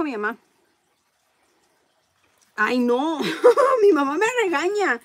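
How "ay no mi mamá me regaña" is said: laughs through the words, voice pitched up; giggling while complaining about mom; laughing. Real, not acted. Seller laughing to viewers about her mom scolding her.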